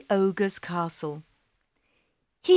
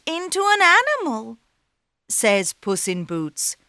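cat voice; 8 semitones higher, 5 dB louder than usual. Acted. Cat flatters ogre.

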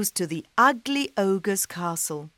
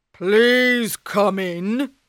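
ogre voice; 3 semitones higher, 6 dB louder, much slower than usual. Acted. Ogre invites.

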